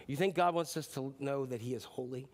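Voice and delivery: ordinary talk, nothing marked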